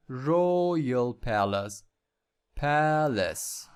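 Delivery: Royal palace drawn out very slowly; slow model. Shown as a demonstration. Teacher modelling for students, very slow and clear. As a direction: say each syllable of Royal palace slowly for learners to copy.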